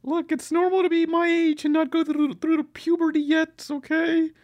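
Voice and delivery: high, squeaky mock-kid protest; prepubescent kid impression. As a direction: squeak like an offended boy before puberty. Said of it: "someone who hasn't gone through puberty yet".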